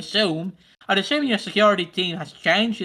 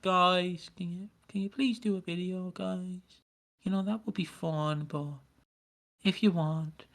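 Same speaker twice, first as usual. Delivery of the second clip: softer, timid, trailing request; a limp mock plea to the cast, much softer; meek half-hearted ask; 4 semitones lower, 9 dB quieter than usual. Shown as a demonstration. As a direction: ask as if you expect a no.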